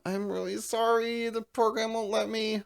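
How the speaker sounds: a sorry, high little clerk voice; apologetic clerk. Retold or imitated. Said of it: "she goes".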